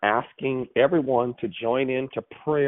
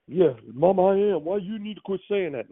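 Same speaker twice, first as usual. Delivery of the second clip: lightly voiced son talking back, higher pitch; baby brother's protest; 8 semitones higher than usual. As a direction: imitate a son pleading his case to mama. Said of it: "he called me the other day".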